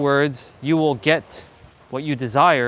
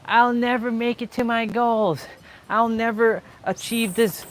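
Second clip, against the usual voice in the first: higher, whiny self-doubting voice; mock defeatist voice; 6 semitones higher than usual. Mocking viewers who talk themselves down: high whine. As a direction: play the hopeless quitter, pitched up. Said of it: "But if you start saying".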